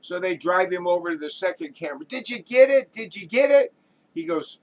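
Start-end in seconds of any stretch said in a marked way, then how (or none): 2.1-3.7 high, loud, urgent questioning; eager director. Acted. Director to second cameraman, urgently asking. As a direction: press hard for good news.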